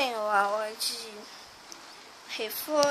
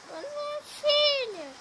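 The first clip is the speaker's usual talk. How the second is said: much higher, gentle mom reply; mother voice; slower than usual. Acted. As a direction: answer like the mom. Mother answers goodnight.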